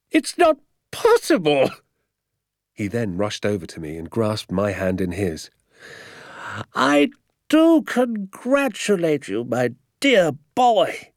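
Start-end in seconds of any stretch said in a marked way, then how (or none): 0.1-1.7 high, slow squeal of amazement; hooting disbelief. A narrator's reading. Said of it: "he cried". 6.7-11.1 high, drawn-out jubilant cry; ecstatic congratulation. A narrator's reading. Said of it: "he cried, pumping my hand".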